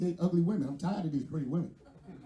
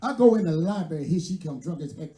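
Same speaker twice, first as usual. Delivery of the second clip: ordinary talk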